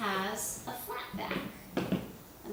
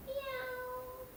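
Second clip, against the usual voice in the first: "meow" as a very high, soft cat mew; tiny high kitten meow; kitty meow; 14 semitones higher, 8 dB quieter than usual. Pretend. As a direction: play a little cat for the kids. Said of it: "say meow like the cat".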